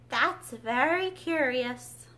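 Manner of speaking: very slow, drawn out; long breath. A narrator's reading. Mock Turtle musing over Alice's story, slow. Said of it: "drew a long breath and said".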